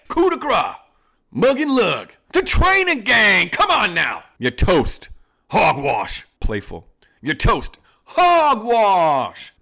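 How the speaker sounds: milk every fancy word like a proud outlaw; high, hammed-up outlaw boss. Boss reminding his gang of old rides, in a high hammed-up outlaw voice. Boss recalling heists then turning on bandit.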